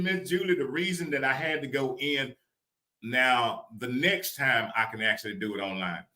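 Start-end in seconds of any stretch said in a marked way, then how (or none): none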